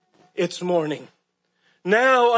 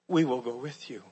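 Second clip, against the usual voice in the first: hushed disciples' reply; 10 semitones lower, 9 dB quieter, faster than usual. Acted. Disciples answering Peter.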